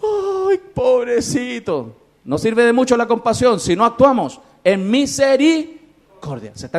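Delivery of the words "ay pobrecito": mocking someone who only says poor thing; mock pity. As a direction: imitate empty hand-wringing pity. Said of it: "el que dice".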